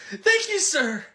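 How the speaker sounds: high laughing thank you; mock delighted employee; grateful employee. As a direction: thank the boss with a giddy laugh.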